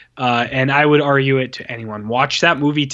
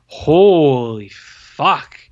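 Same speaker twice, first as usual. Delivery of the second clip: slow, drawn-out and louder; disgusted exclamation; 6 dB louder, much slower than usual. Real, not acted. Movie reviewer recalling a bad film, slow loud disbelief. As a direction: stretch out Holy fuck in disbelief.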